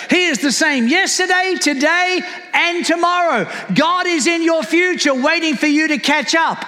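insistent, exhorting preacher; impassioned preaching. Real, not acted. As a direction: drive each point home to the congregation. Preaches at full force.

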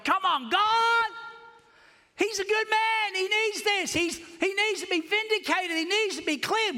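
crying out to heaven on the back porch; desperate pleading cry. Retold or imitated.

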